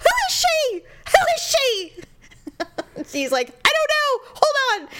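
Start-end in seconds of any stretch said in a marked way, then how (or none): 0.0-2.0 very high, mock-furious screech; hammed-up jealous interrogation, second one drawn out; jealous demand. Wife demands to know about the retweeted girl, mock jealous outburst. Retelling jealous quarrel with husband. 3.5-4.9 very high, mock-panicked husband; freaking out. Husband questioned by wife about a retweeted girl, mock freak-out. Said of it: "he's freaking out".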